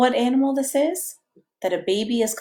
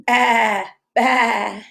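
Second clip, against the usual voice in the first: sheep bleat; 3 dB louder than usual. Shown as a demonstration. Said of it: "What sound does a sheep make".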